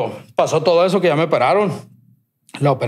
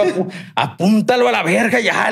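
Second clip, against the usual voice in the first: higher, fed-up griping; exasperated rant; 6 semitones higher than usual. Retold or imitated. Fed up with doctors' questions.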